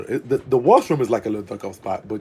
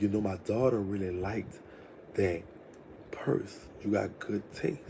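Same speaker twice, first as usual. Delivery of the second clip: slow smooth voice; 10 dB quieter than usual. Retold or imitated. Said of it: "when I'm mimicking his voice".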